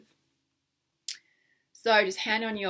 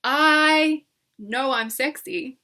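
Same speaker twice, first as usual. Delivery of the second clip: higher, louder, slow; confident, slow; 5 semitones higher, 7 dB louder, much slower than usual. Shown as a demonstration. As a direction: own it slowly.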